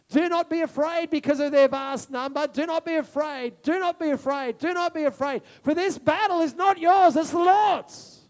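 high, loud ringing sermon voice; full-force preaching. Acted. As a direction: preach it out with fire. Preaching the scripture passage.

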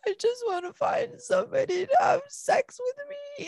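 high, wailing whine; mock crying. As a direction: imitate your own sobbing complaint. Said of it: "I started crying and I said out loud".

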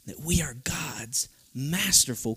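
a hushed, slow breath of awe; whisper. Real, not acted. Preacher whispering to the congregation about their worth.

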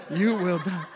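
naively delighted reading of a threat; comically thrilled, half laughing; mock excited. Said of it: "I was, like, excited".

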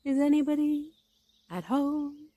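high, sustained call into a hole. A narrator's reading. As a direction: call to see who is in. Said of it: "put his head through the hole and called out".

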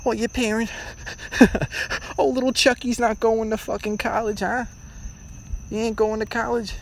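high snooty mock voice with a laugh; high mock parent-friend voice; mocking gossip. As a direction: mimic the judging family friends sneeringly. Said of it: "where they're like".

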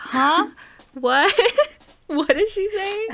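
loud, high-pitched baffled questions; exaggerated loud, rising confusion; mock straining to hear. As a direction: call it out like you can't hear, half laughing. Acts out failing to hear whisperer.

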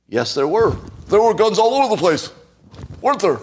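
high, loud sarcastic yes; sarcastic mock agreement. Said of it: "I can't even take that seriously".

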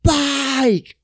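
high wailing mock yell; drawn-out high cry; kid's cry. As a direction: imitate an upset kid crying out. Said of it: "then I seen the kids".

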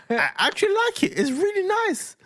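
put-on very high little-boy voice; high-pitched earnest child impression; imitating pleased son. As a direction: mimic a kid insisting he approves. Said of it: "and he went".

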